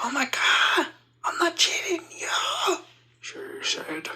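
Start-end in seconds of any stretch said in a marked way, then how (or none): 0.0-2.8 put-on high, frantic girlfriend voice; protesting girlfriend. Girlfriend answers boyfriend's cheating accusation, high-pitched protest. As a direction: cry out in a shrill mock-girlfriend protest.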